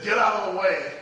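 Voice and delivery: loud final order to the pews; retold shout. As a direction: clear the aisle with your voice. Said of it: "finally he just said".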